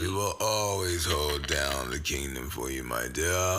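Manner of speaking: lightly voiced regal king; grand rich voice. Royal roleplay, deeper repeat.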